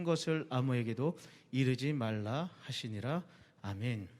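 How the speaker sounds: low, measured Bible reading voice; scripture reading. Acted. Pastor reading scripture to the youth group, solemn and measured.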